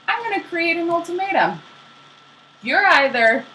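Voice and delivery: high-pitched mock indignation; hammed-up angry mother; mad ultimatum. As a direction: lay down a threat in a high voice. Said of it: "She was so mad".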